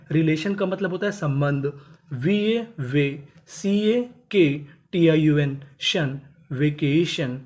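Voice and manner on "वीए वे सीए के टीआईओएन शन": very slow spelling; बहुत धीरे, अक्षर-अक्षर; syllable by syllable. Shown as a demonstration. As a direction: drag out each syllable. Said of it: "spelling out syllables".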